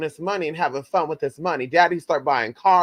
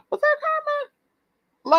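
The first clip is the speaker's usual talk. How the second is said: high incredulous question; much faster than usual. Real, not acted. Asking God about karma.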